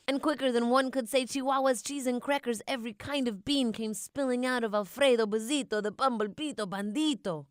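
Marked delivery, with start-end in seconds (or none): none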